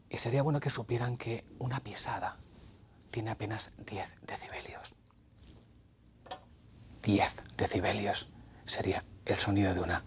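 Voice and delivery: soft unvoiced whisper, barely there. Shown as a demonstration. Radio host whispering to show a ten-decibel footstep. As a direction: breathe the words like a secret. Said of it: "es la voz de los secretos".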